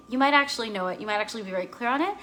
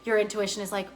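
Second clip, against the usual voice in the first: ordinary talk, nothing marked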